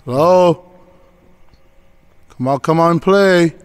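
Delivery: loud, high call into emptiness; calling into the dark. Real, not acted. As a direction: shout out to whoever is there.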